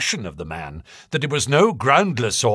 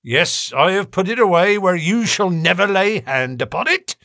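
exultant; 8 semitones higher, 3 dB louder than usual. A narrator's reading. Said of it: "he cried exultantly".

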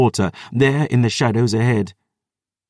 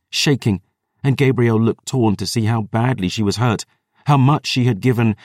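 ordinary delivery, nothing marked

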